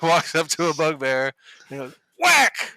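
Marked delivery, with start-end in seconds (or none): 2.2-2.7 sudden high, loud outburst; shouted whack. Acted. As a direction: punch it out like a slapstick blow. Acting out the goblin's hit.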